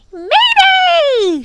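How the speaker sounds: very high, loud drawn-out shriek; shrieked alarm. A narrator's reading. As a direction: screech the alarm like a bird. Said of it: "she shrieked".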